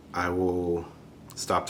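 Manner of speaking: normal talking voice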